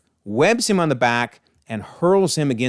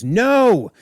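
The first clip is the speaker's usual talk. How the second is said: high, loud dramatic cry; hammed-up anguished shout; mock scream; 9 semitones higher, 5 dB louder than usual. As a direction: cry it out in acted agony. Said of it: "as Cain screams".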